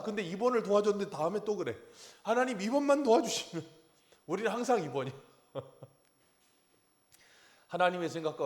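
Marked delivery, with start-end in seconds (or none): 2.2-3.7 mock pleading prayer.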